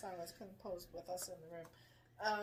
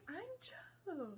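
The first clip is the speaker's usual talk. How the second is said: put-on English accent; 11 semitones higher, 4 dB quieter than usual. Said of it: "in his English accent".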